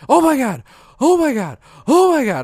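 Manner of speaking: high, loud imitated panic; mock panic. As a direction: act out the wife waking in a panic. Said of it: "just panicked".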